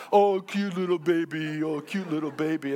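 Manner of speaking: imitating gooey baby talk. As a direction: coo over a baby, mock-goofy. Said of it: "they start talking like a baby".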